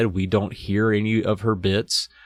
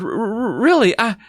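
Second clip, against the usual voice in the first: stammering; 12 semitones higher than usual. A narrator's reading. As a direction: trip over the words. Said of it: "Chris stammered".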